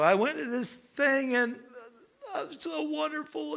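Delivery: mock-weepy kid, high voice; imitated tearful testimony. Preacher imitates kids back from camp, tearful gushing. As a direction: imitate a teenager overcome at youth camp. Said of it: "these kids are just crying their eyeballs out".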